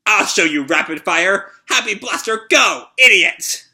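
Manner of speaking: a loud furious battle cry; fierce shout. Acted. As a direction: shout it while firing at the boss. Girl shoots the boss.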